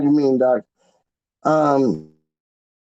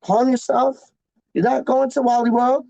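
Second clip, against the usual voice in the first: high, mock soothing voice for his friend; imitating Wally; 8 semitones higher than usual. Retelling how Wally calmed him, in a high mock voice. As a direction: play Wally calming him down, pitched way up. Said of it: "literally went like this".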